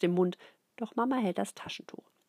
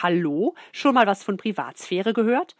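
put-on loud, outraged baby protest; indignant; 10 dB louder than usual. A narrator's reading. Baby Ruby protests loudly as mama lifts blanket. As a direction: bristle at the intrusion. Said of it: "Ruby empört sich".

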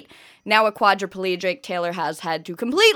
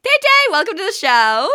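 excited greeting; 10 semitones higher, 6 dB louder than usual. Real, not acted. Host greeting guest excitedly.

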